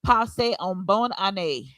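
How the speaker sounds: slow Creole with a foreign missionary accent; careful missionary Creole wish. Shown as a demonstration. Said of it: "can you give us a small demonstration".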